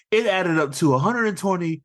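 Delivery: rising boy narrator imitation; Squints. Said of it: "a part in his narration".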